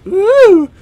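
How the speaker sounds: loud, high, rising whoop; excited ooh. Real, not acted. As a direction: coo loudly over a new gadget. Reacts to new inspection hardware.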